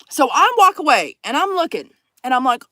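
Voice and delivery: ordinary talk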